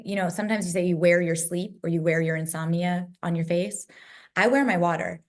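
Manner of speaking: usual speaking voice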